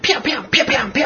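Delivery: high pew pew gun noises; mock gunfire. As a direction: fake laser shots by mouth. Imitated gunfire.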